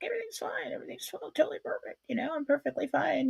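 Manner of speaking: high, fast, breathy sarcasm; sarcastic inner voice. Retold or imitated. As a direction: rattle it off in a mock-cheerful squeak. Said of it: "I'm thinking this in my head".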